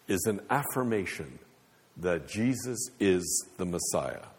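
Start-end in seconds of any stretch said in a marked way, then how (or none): none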